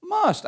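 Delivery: high-pitched stress on "must"; emphatic "must". Real, not acted. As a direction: hit "must" as a single high, emphatic word. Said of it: "I stress the word must".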